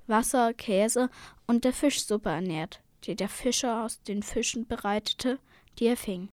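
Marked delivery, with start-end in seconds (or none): none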